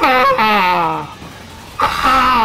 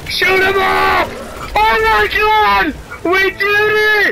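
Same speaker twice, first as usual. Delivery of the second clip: triumphant shouting; 8 semitones higher, slower than usual. Real, not acted. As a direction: yell in victory, slowly. Winning the fight, shouting.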